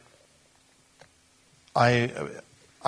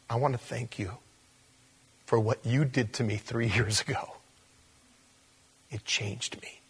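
soft thanks; 6 dB quieter than usual. Retold or imitated. Grateful student thanking teacher.

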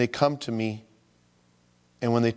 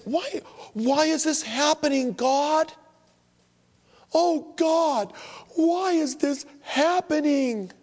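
high, wailing plea to God; desperate wailing prayer; slower than usual. Retold or imitated. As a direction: cry out to God in despair. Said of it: "I prayed that way".

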